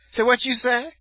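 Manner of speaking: very high, loud, rising mock outrage; singsong auntie. Aunt challenging nephew's swearing, high loud singsong. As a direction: imitate the aunt's sung-out shocked question. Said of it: "they all talk like they singing".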